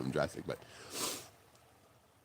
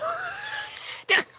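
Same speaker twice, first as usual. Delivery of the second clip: laughing hard. Real, not acted. Laughing at the radio call.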